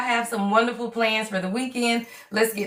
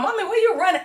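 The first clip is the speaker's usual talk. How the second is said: put-on child's voice; 8 semitones higher, much faster than usual. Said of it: "And my kids talking".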